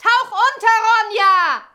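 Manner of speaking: high-pitched warning cry; urgent shout. A narrator's reading. Birk warns Ronja to dive, shouting. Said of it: "schrie Birk".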